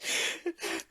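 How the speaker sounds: breathy suppressed giggle; holding back a laugh and failing; stifled laugh. Real, not acted. Player over the dead crab, stifled giggle. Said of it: "I'm trying so hard not to laugh".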